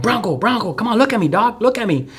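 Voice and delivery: high, urgent call to a fading friend; calling out to keep him awake. Retold or imitated. Narrator holding dying friend, high urgent calling. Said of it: "and like".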